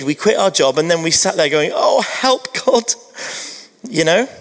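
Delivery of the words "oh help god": mock plea. Acting out panicked plea to God.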